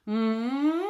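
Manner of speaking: closed-mouth NG hum rising. Shown as a demonstration. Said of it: "we think it while we're in that N G space".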